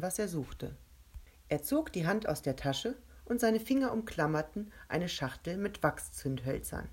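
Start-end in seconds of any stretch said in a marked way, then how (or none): none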